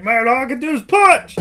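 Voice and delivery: high, loud, whiny hero voice; mock Rocky whine. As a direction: whine it like a beaten boxer. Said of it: "And he's like".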